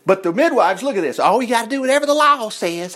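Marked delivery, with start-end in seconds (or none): 1.2-2.9 high, put-on pious preacher; imitating a legalistic preacher; mock preacher. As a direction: mimic a rule-bound preacher, pitched up. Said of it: "I've heard that preachers".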